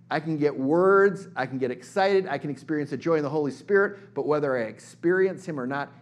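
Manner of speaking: loud, rising preacher's cry; preaching at full force. Real, not acted. Preacher lifting up joy in the Spirit, loud and fervent.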